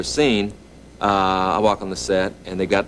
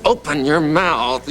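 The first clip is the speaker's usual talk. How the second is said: slow, higher coaching voice; slow coaxing teacher; 7 semitones higher, 4 dB louder, much slower than usual. Retold or imitated. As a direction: coax the mouth open, word by word. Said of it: "He says".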